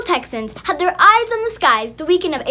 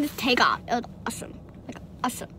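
whispering; 6 semitones lower, 14 dB quieter, much faster than usual. Real, not acted. Vlog intro, sudden hush.

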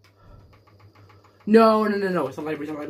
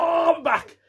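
tripping and falling; 8 semitones higher than usual. Real, not acted. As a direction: yelp while collapsing. Falling over mid exercise.

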